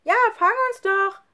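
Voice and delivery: put-on very high, loud child's dare; gleeful taunting call. A narrator's reading. Nele runs away from Lukas, taunting call. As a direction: egg him on, sing-song and shrieky. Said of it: "rief Nele und rannte weg".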